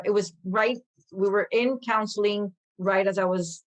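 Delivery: ordinary delivery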